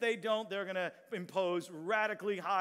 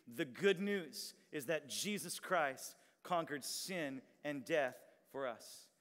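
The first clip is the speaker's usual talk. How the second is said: slow read-aloud; 5 semitones lower, 4 dB quieter, slower than usual. Shown as a demonstration. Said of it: "Let's all read this aloud together".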